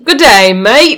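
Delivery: put-on Australian accent. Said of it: "Australian accent".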